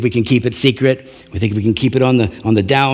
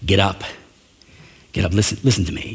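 softer and lower, urgent but calm; gentle instruction; 6 semitones lower, 5 dB quieter, faster than usual. Retold or imitated. God gently telling a mourning Joshua to listen, calm and soft. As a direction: coax a grieving child to listen. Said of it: "he needs Joshua to listen".

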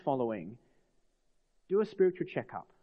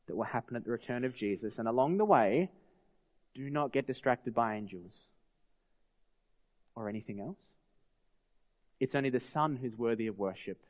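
ordinary talk

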